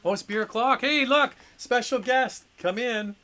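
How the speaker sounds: high, loud happy welcome; excited greeting. Real, not acted. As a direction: greet a surprise guest with delight. Wife arrives with beer, greeting.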